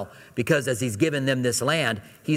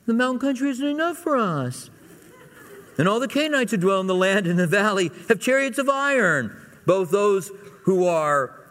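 high-pitched, put-on whine; whiny kid protesting, pitched way up; real whiny voice; 7 semitones higher than usual. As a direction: read it as a whining complaint. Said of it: "with a real whiny voice".

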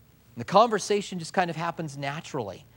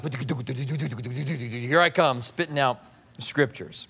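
self-mocking swagger; 4 semitones lower, much slower than usual. Mocking his young pastor self.

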